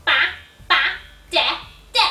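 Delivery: put-on short, separate baby syllables. Shown as a demonstration. Class imitates a baby, short babble. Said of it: "like a baby, we say".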